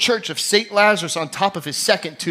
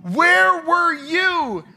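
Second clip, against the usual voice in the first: anguished cry to God; 11 semitones higher, much slower than usual. Retold or imitated. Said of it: "tragedy go".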